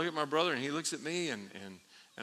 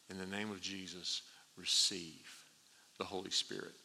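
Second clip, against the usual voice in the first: quiet prayer; 3 semitones lower, 6 dB quieter than usual. Retold or imitated. Pastor's quiet prayer over people.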